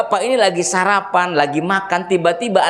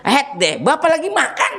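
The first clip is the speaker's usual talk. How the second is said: lazy father; 10 semitones higher than usual. Retold or imitated. Lazy father refuses child.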